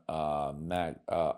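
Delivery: ordinary talk, nothing marked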